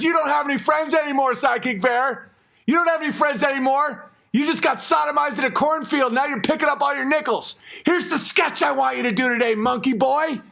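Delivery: high mocking shouts, partly sung; taunting shout. The boss mocking the bear, high taunting shouts.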